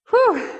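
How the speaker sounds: high, loud puff of relief; breathless whew. Real, not acted. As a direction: blow out a winded whew. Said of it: "Even I'm out of breath".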